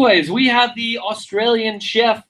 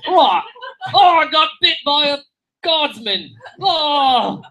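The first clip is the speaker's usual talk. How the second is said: higher, hammed-up English accent; mock English wail; 7 semitones higher, slower than usual. Comic playing bitten Englishman, put-on English accent. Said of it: "That's my English accent".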